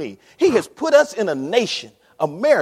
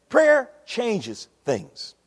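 "Prayer changes things" drawn out word by word; very slow, weighty delivery; 4 semitones higher, much slower than usual. Real, not acted. Preacher drives the point home to the church, very slowly. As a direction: land each word slowly. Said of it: "slower repetition".

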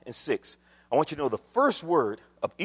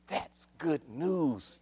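hushed, stretched-out delivery; quiet savoring; 9 dB quieter, much slower than usual. Real, not acted. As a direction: draw it out gently. First quiet take before exclamation.

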